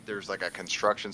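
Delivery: ordinary delivery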